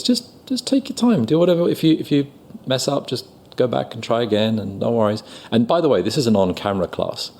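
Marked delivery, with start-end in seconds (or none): 0.0-5.2 high, gentle encouragement; reassuring teacher. Retold or imitated. Teacher calming the sweating student, high and gentle. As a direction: soothe the nervous student. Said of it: "and he says".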